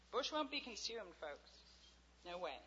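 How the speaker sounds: ordinary delivery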